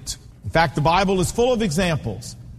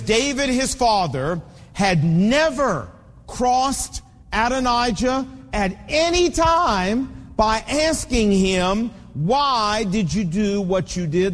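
scripture reading; slower than usual. Real, not acted.

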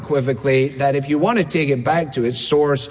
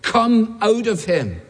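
stern, slow command in a raised pitch; Jesus; 6 semitones higher, slower than usual. Retold or imitated. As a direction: order it out firmly, word by word. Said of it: "said Jesus sternly".

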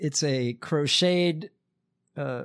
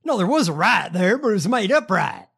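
louder, higher mock denial; mocking imitation; 4 semitones higher, 6 dB louder than usual. Host mimicking a politician's riot denial, mocking. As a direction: parrot the denial with loud derision.

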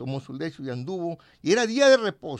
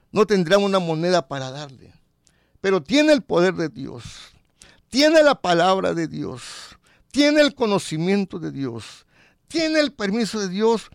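louder, slowed preaching; 3 semitones higher, 5 dB louder than usual. Acted. Preacher lifts up the humble brother, louder. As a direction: pound out each tiene. Preacher builds emphatic list.

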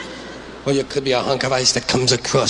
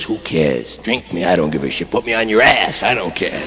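a slacker who couldn't care less; lightly voiced lazy water; 8 semitones lower than usual. Comic voicing water as a careless slacker, lower lazy tone. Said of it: "Water says".

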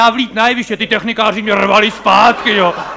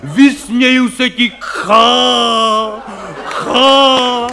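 mock hammy hero; 4 semitones higher, much slower than usual. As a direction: bellow it like a ham actor defying the wind. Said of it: "křičej tam, prostě řvou". (přehrávaný hrdina hlasitě a pomalu řve na smrt)

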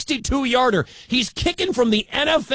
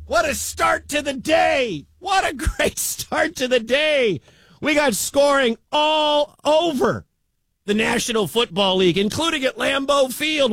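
higher, hyped shouting; excited shout; 6 semitones higher than usual. Real, not acted. Radio announcer hyping the day of football, shouting. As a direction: pump up the listeners.

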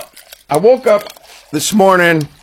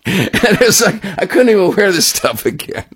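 laughing; 5 semitones higher than usual. Real, not acted. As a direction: chuckle it out as you finish the story. Laughing at his own story.

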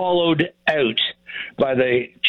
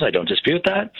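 normal talking voice

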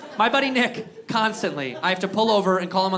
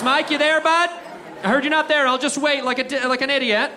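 raised-pitch dopey caller; mock calling out; 4 semitones higher than usual. Fool at a buddy's empty house, mock calling out. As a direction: call out hopefully, hammed-up and higher.

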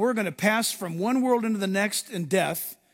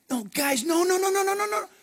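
acted protest; 9 semitones higher than usual. Jesus acted, hurriedly reassuring the disciples.

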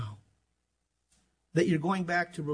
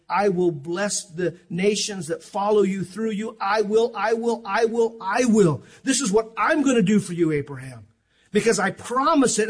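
preaching at full force; 4 semitones higher, 6 dB louder than usual. Acted. Preacher booming God's oath to Abraham. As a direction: build each I will into a shout.

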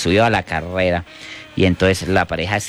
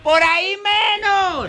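very high, drawn-out falsetto cry; joke woman crying out; slower than usual. Acted. Back-seat passenger to her lover, shrill falsetto cry. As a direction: squeal it slowly, way up high.

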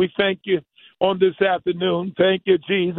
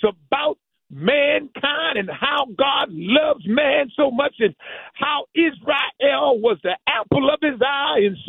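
preaching higher and rising to a peak; 4 semitones higher than usual. Acted. Preacher to congregation, building to fervent higher preaching. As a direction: lift the congregation with rising fervor.

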